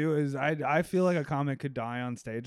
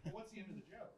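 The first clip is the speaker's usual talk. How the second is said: higher, hushed puzzled onlooker; mock a confused audience member, pitched up and soft; 8 semitones higher, 20 dB quieter, faster than usual. Audience asking about the dead comic's punchline, puzzled. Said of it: "everybody's like".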